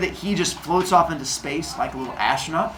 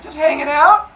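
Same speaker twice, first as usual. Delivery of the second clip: fake casual; 9 semitones higher, 4 dB louder than usual. Cronus feigns innocence.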